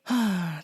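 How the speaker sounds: long sigh of food bliss; rapturous sigh. Real, not acted. Traveler raving to listeners about Japanese food, rapturous sigh.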